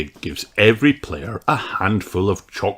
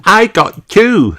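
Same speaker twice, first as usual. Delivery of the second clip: higher, louder, slow proud boast; proud child; 12 semitones higher, 8 dB louder, slower than usual. Acted. Peppa brags to Susie, proud high voice. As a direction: brag about it.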